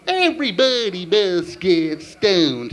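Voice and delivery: very slow cartoon moose sing-speak. Drunk karaoke, very slow cartoon moose voice. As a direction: drag each word out like a dopey moose. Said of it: "let's try this one".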